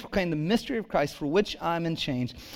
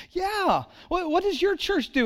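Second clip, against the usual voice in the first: gushing lady; 12 semitones higher than usual. Retold or imitated. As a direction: gush like a delighted older lady. Lady at estate sale voiced.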